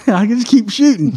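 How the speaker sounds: breathless high laugh into the line. Real, not acted. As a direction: laugh through the words. Laughing at own story.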